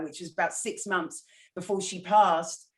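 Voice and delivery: ordinary talk, nothing marked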